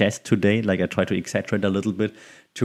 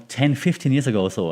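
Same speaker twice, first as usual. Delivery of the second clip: ordinary delivery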